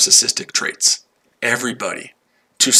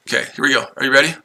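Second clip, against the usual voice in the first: ordinary talk, nothing marked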